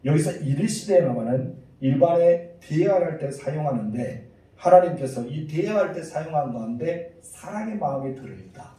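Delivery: usual speaking voice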